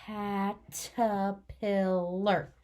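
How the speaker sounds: Caterpillar drawn out very slowly, every sound held; stretched out. Shown as a demonstration. Teacher stretching out Caterpillar for the children, very slow. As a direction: pull Caterpillar apart sound by sound. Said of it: "Let's stretch it out".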